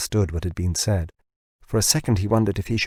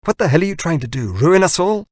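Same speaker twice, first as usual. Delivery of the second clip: much higher, fast, loud angry outburst; voice rising, furious; 9 semitones higher, 5 dB louder than usual. A narrator's reading. Furious founder accuses Larry of ruining them, high, fast and loud. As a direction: rise to a furious pitch, racing. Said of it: "his voice rising to a pitch".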